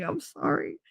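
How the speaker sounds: whispered, small sorry; meek apology. Retold or imitated. Mom to her stressed-out son, whispered meek apology. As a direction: apologize under your breath.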